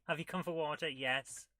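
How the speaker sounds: ordinary talk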